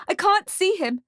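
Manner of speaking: high, loud cries for help; frantic, panicked mother; shouting. A narrator's reading. Mother cannot see her son, shouting for help. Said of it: "shouts the woman".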